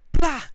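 loud high burst of blah, retelling the chest slap; loud mock blah. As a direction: shout blah like a sudden whack. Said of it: "he does it again".

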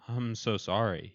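a mildly wailed apology. A narrator's reading. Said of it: "he wailed".

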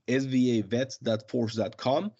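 quick web address read-out; a little fast. Real, not acted. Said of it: "you said it a little fast".

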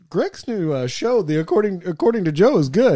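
high-pitched wife impression. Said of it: "my wife, who doesn't listen to this podcast, goes".

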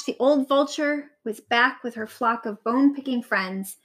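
ordinary delivery, nothing marked

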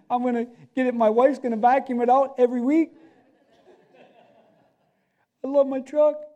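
high, gushing mock delight over a new truck; preacher mimicking a man thrilled with his new truck, gushing; gushing truck owner.

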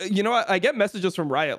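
ordinary talk, nothing marked

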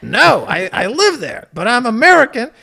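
high, loud acted-out protest; emphatic protest. American mistaken for a European at lunch, emphatic protest. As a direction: set them straight with exasperated force.

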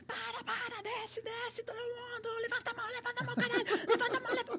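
high, frantic mock cop yelling; hammed-up police command, pitched high; police shouting orders. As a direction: bark orders like a panicked cop, laughing through it. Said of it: "fizeram a gente parar o carro".

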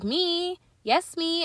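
put-on very high, squeaky baby voice; proud baby. A baby proudly answering its cooing parents, squeaky.

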